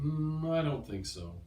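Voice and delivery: reluctant. Shown as a demonstration. Teacher demonstrating someone who doesn't want to, reluctant. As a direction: start high on a long doubtful hum, then decline. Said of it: "whether someone really doesn't want to".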